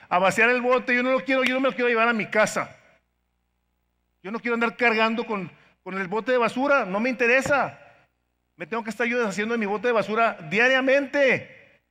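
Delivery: loud, high, driving sermon voice; fiery preaching. Acted. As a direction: hammer it home to the congregation. Preaching at full force. (predicación enérgica y a gritos)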